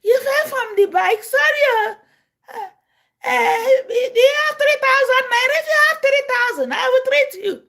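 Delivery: high, loud, crying mock plea; imitating Kaleri. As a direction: whine and beg for money through fake tears. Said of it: "I want to talk like Kaleri".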